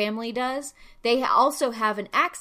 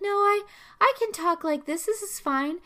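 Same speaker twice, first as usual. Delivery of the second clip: high, prim insistence; prim polite girl; 10 semitones higher than usual. Retold or imitated. Osaka girl insists on speaking politely, high and prim. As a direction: insist on staying polite, much higher. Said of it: "She's like".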